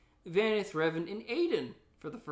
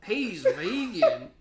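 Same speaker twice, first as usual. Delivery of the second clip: put-on laughing old prospector; 6 dB louder than usual. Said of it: "and then there's Old Prospector".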